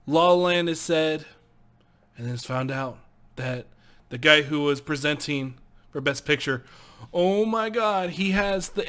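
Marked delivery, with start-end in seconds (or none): none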